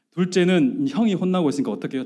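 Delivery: normal talking voice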